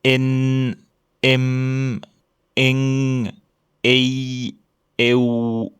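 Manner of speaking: each sound hummed and held; sustained final sounds. Shown as a demonstration. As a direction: hold each ending like a hum. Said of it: "Les sons concernés sont".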